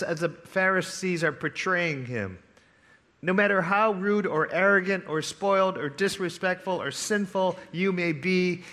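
usual speaking voice

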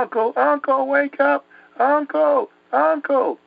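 very high mock cry for help; retold screaming. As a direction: act out a terrified boy calling someone awake. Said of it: "I started screaming".

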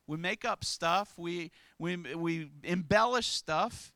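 ordinary delivery

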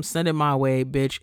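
normal talking voice

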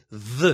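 ו said as a voiced th like in "this"; soft dalet ו as a buzzing th; Yemenite soft dalet. Shown as a demonstration. Lecturer demonstrating the Yemenite soft dalet, voiced th. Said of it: "הלא דגושה זה".

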